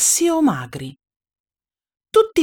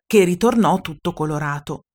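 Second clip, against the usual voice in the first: ordinary delivery, nothing marked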